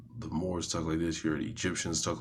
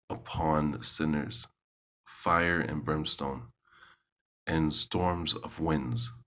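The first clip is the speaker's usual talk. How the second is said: scripture reading; slower than usual. Acted. Reading a psalm aloud to listeners, solemn and measured. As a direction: read it out like scripture in church.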